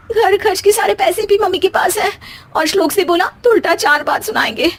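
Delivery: usual speaking voice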